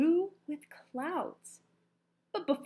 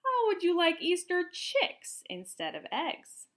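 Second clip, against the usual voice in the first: slow, higher persuasive offer; slow, higher coaxing offer; coaxing pitch; 8 semitones higher, 4 dB louder than usual. A narrator's reading. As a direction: sell it slowly and sweetly. Pennywort pitching chicks.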